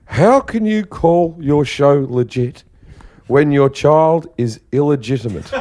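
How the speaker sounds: slow, mock-stern mother; imitating mum. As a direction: deliver the jab slowly, lightly voiced. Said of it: "my mother's exact words were".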